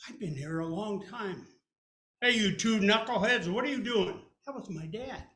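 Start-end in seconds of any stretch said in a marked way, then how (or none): 2.2-4.3 much louder dad shout; dad calling out. Retold or imitated. Dad calling out to the two knuckleheads. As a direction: call across the kitchen.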